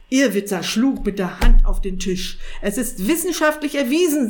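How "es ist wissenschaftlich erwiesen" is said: high, loud, sharp assertion. A narrator's reading. Sorcerer contradicting the cat, sharp. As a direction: lay down the law sharply, voice raised. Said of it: "sagte er scharf".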